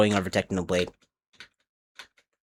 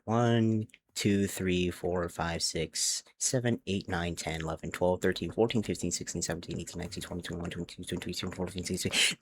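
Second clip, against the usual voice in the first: quiet counting; 5 semitones lower, 9 dB quieter than usual. Real, not acted. Counting blocks under breath.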